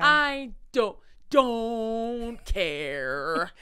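long drawn-out "don't care"; exaggerated indifference. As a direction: stretch each word to show how little you care. Exaggerated indifference to name-droppers.

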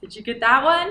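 usual speaking voice

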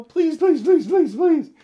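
high, loud, pleading please; shrill, loud begging like a desperate child; frantic begging. Shown as a demonstration. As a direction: beg wildly, way over the top. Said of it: "jump up and down and say".

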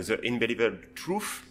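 mock English accent on the title; lightly put-on English accent, said with self-mockery. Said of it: "avec mon super accent anglais".

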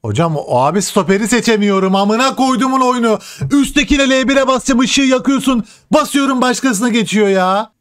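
high exasperated complaining; exasperated gamer whining; frustrated rant. Real, not acted. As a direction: rant at the game, pitch way up. Frustrated rant at game controls.

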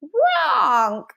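high, drawn-out honk; squawking baby dragon. A narrator's reading. Dragonling struggles to get away, squawking. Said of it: "it squawked".

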